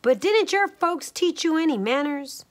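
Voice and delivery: high, scolding mother bear; lightly acted stern question. A narrator's reading. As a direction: tell off a youngster. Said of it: "Mama Honey Bear said sternly".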